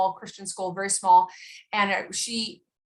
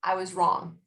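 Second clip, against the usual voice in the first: "I was wrong," said plain with no buts; just "I was wrong," full stop; flat admission. Shown as a demonstration. Parent owning the mistake to their child, said plainly. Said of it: "and no buts to it".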